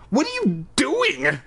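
put-on shocked, crying out at the girl; startled yell at the girl on his back. A narrator's reading. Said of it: "I cried".

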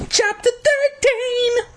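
very high, slow squealed heading; silly drawn-out falsetto announcement; hammed-up chapter announcement. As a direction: shriek out the heading in mock fanfare.

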